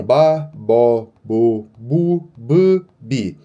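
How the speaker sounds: ba, bo, bu, bi said crisply one by one; reading syllables aloud. Shown as a demonstration. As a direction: drill each syllable clearly. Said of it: "Então". (sílabas lidas uma a uma)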